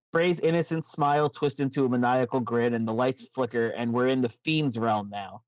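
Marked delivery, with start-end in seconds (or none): none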